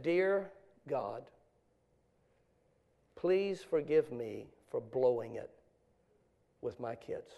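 very soft, low and slow; quiet repentant plea. Retold or imitated. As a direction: plead humbly, pausing between phrases. Voices father's repentant letter to God.

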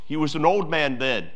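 ordinary talk

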